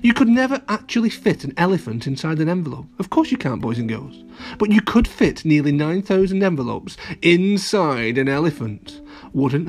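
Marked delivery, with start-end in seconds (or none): none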